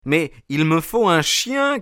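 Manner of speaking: high, insistent call; crying out. A narrator's reading. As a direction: call up to the window, pleading your case. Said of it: "criai-je".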